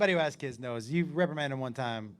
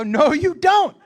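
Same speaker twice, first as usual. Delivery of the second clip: raised, higher and louder, laughing through it; firm loud retort; 10 semitones higher, 12 dB louder, slower than usual. Retold or imitated. Dad cutting off son's claim, loud and amused. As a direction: shut him down hard. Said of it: "I go".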